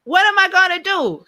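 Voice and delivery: high, loud cry of mock alarm; mock dismay. Coach acting out a business owner's wrong shipment, mock dismay. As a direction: play up the exasperated panic.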